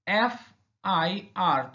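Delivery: एफ़आईआर said slowly as F-I-R, starting on the vowel sound ए; pronunciation demo. Shown as a demonstration. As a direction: spell out एफ़आईआर slowly letter by letter. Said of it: "इसका pronunciation कैसे किया जाएगा?". (एफ़आईआर को धीरे-धीरे ए से शुरू करके बोलना)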